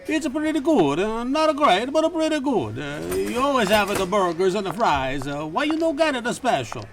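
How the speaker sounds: high put-on Italian cook greeting a regular; diner owner Marconi. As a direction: pitch it way up as the chatty cook. Marconi answers about business.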